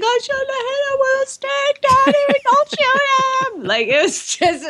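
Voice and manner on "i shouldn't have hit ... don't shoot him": very high mock wail of a little girl; melodramatic. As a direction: plead with daddy, hammed up. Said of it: "as melodramatic".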